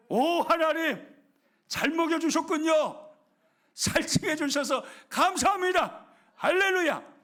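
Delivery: joyful thanks. Acted. Believer thanking God after changing mind, loud exultant praise. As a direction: burst out in grateful joy. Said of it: "생각을 바꿔보십시오".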